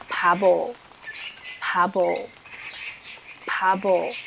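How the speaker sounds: pabo drawn out very slowly, p then b; slow vocabulary demo. Shown as a demonstration. Teacher reading a new word for students, very slow. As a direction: pabo said syllable by syllable for students to copy. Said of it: "《傻瓜》".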